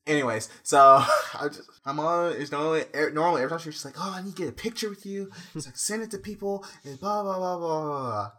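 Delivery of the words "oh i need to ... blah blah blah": high-pitched mock-mother chatter; imitated gushing mom. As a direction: parrot a fussing mom, trailing into singsong filler. Imitating mother's usual picture request.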